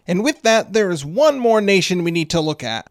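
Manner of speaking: ordinary talk, nothing marked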